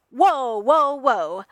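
loud, startled interruption; whoa, cutting in. Acted. Tony loudly cutting off Reed's criticism of his sensors, startled. Tony cuts Reed off defensively.

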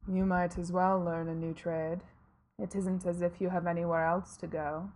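low, far-off reaper voice; grim reaper voice. Acted. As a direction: speak as Death, low and measured. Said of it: "said Death".

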